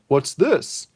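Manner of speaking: pleasantly surprised; curious Clark. Acted.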